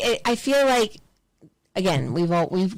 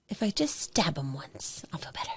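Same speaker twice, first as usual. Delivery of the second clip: muttered dark thought; 3 semitones lower, 10 dB quieter than usual. Retold or imitated. Venting about an ex to friends, hushed whisper. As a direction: whisper it as if thinking aloud.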